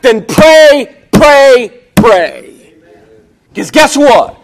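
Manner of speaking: loud, driving call-and-answer preaching; fired-up preaching. Real, not acted. Preacher urging the church to pray, fired-up preaching. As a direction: build to hammered, shouted one-word commands.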